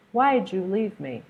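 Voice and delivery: "Why'd you" joined with a j sound, whyju; reduced why'd you. Shown as a demonstration. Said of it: "did you hear that j sound".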